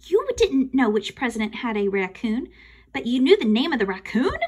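plain reading voice

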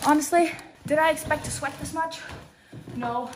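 ordinary talk, nothing marked

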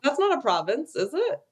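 high, puzzled question; surprised. Real, not acted. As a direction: sound genuinely surprised. Surprised question about territories.

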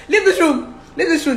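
high, loud dismissal; retold angry order. Woman ordering her partner out mid-quarrel, angry. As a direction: act out ordering someone out.

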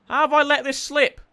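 ordinary delivery

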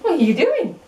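squeaky dog voice. Acted. High talk to puppy.

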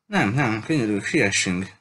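usual speaking voice

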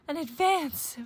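high, loud, urgent cub; excited sputter. A narrator's reading. Brother blurts his demand to Papa, sputtering. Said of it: "sputtered brother".

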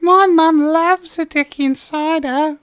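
put-on high, slow little boy voice; chirpy Aussie boy ad line; Aussie kid voice. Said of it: "little kid ... he goes".

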